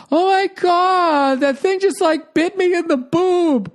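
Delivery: high, slow, put-on whiny woman; mock the woman's shocked whining, way up high; whiny drama voice. Mocking the bitten tourist's high drawn-out whine. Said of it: "she's doing that whole".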